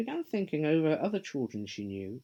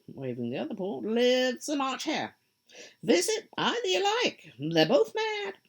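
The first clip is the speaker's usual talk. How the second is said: Cheshire Cat; 7 semitones higher than usual. Acted.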